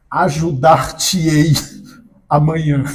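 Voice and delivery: ajudar-te-ei said slowly with a laugh; mock formal mesoclisis. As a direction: lightly act out a formal ajudar-te-ei. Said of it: "a Laís vai responder pra ela assim". (ajudar-te-ei dito devagar, rindo)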